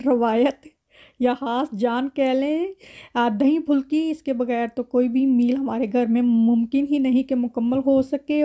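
normal talking voice

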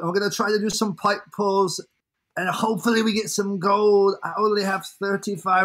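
put-on English-accented presenter; Shiny impersonation. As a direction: mimic a British video host's intro. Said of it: "I will do a shiny impersonation now".

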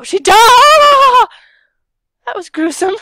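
high, loud gasp of shock; shocked shout. Real, not acted. Seeing a character die in the game, shocked shout. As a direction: yell it out in horror.

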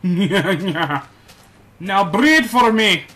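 loud, high hammed-up order; mock command. Player ordering cows to breed, gleeful shout. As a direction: command the cows like a mad ruler.

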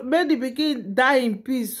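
ordinary talk

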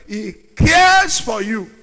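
loud and firm, stress on "cares"; emphatic repeat. Acted. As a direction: drive home "cares". Preacher's repeat.

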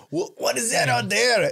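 high, loud mock alarm; hammed-up excited discovery; acted astonishment. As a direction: play a searcher startled by a sighting. Acts out searchers spotting something.

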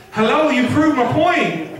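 usual speaking voice

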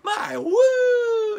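high, loud, drawn-out whoop on woo; strained wrestler's woo. Pretend. Collector says his wrestling whoop is broken, high loud whoop. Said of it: "My voice is gone".

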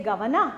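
"governor" ending in an open "ah"; British, r dropped. Shown as a demonstration. Teacher showing British English, "governor" without any r. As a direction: say "governor" as "guvanah", no r. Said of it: "R is never there".

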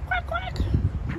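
high squeaky duck quacks. Pretend. Quacking high and loud at a baby duck in the park.

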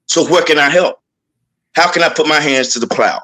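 usual speaking voice

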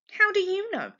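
high, sharp challenging question; indignant challenge. Acted. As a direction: fling it back defiantly. Jess arguing with mother.

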